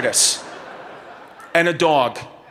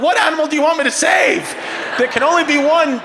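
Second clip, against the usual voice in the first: mock shout; 10 semitones higher than usual. Therapist yelling back at the wife over which pet to save. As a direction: yell back in hammed-up desperation. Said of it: "I was like".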